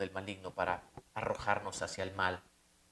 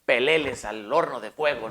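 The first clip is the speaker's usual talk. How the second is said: loud, scornful command to throw them in; contemptuous shouted sentence; angry royal order; 9 dB louder than usual. Retold or imitated. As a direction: bark it like a furious king. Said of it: "ya no les dijo peleles".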